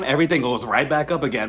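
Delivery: ordinary talk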